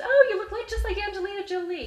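very high, loud mock squeal; gushing. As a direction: squeal in delight at the likeness. Retold gushing while taking pictures.

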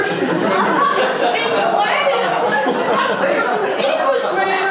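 very high, loud mock holler; hammed-up excited lady shouting for her friend; calling the neighbor. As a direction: yell next door as the amazed lady. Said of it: "I'm gonna call my neighbor".

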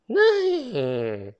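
high, loud playful neigh; whinny like a horse for kids. Pretend. Horse sound for children.

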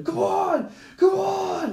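high-pitched imitated scream, egging someone on; crazed goading shout. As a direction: shout it like a man gone wild. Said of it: "just going crazy".

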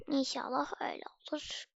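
soft unvoiced aside; whisper. Real, not acted. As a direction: breathe it as a secret. (fısıltıyla)

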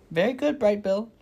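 put-on an old goose praising a gosling; teacher goose cheering the gosling on; Loudwing the old goose. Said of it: "said Loudwing".

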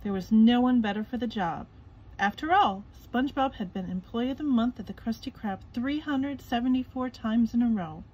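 ordinary delivery, nothing marked

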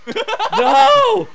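high, loud laughing no; laughing scream. Real, not acted. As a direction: shriek it through laughter. Player attacked by SpongeBobs.

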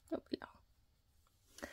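barely audible, drawn-out whisper; whispered reassurance. Real, not acted. Owner telling her dog it won't be long, whispering. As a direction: whisper it slowly to soothe.

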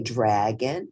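ordinary delivery

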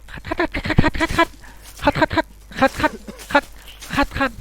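effort chant. Pretend. Player scrubbing up the chimney, high rhythmic effort chant. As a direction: chant คัด in a high strained beat.

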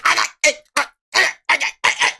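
high strangled choking noises; Bart Simpson. Acted. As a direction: gag in bursts like a throttled cartoon kid. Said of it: "he just starts choking him and Bart's going like".

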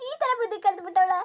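wailing through sobs; a mother crying over a runaway daughter; sobbing lament. Acted. Mother wails to the nephew about her runaway daughter, sobbing.

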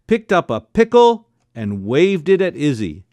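ordinary delivery, nothing marked

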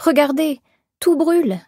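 high, plaintive cry of distress; lamenting. A narrator's reading. Shop owner watching her store burn, plaintive lament. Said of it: "se lamenta-t-elle".

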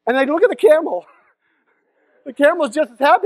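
normal talking voice

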